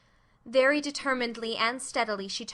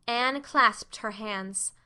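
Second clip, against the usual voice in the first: ordinary delivery, nothing marked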